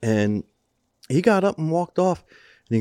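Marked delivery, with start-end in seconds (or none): none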